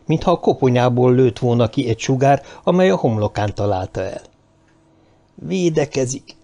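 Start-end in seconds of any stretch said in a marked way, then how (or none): 5.4-6.4 slow, higher, slightly hushed alarm; startled inner voice. A narrator's reading. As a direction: draw it out softly, a little startled. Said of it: "hallotta a lelke mélyén saját megszeppent hangját". (megszeppent, lassú belső hang)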